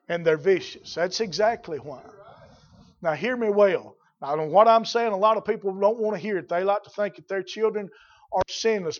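normal talking voice

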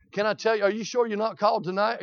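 usual speaking voice

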